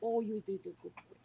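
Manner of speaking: high cooing praise. Real, not acted. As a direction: gush over a good dog. Praising the dog.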